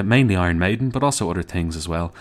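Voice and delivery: ordinary talk, nothing marked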